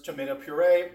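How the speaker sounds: ordinary talk, nothing marked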